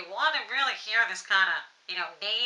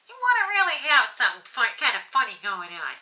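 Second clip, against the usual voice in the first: cartoony voice; 4 semitones higher, 5 dB louder than usual. Shown as a demonstration. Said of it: "You want a cartoony voice sound".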